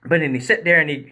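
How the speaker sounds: normal talking voice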